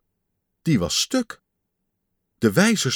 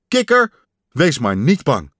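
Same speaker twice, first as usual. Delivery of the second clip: calling out; 3 semitones higher, 6 dB louder than usual. Acted. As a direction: call out to reassure a friend far away. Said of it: "riep Pat". (luid roepend)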